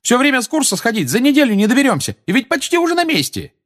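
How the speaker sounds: очень высокий крикливый протест; выкричи жалобы высоким голосом, не сбавляя напора; возмущённый ор. A narrator's reading. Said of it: "заорала Тонкс". (орёт, возмущаясь)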